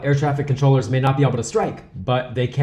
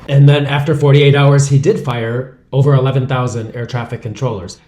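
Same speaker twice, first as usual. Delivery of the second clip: loud emphasis; 10 dB louder than usual. Real, not acted. News narration, emphatic.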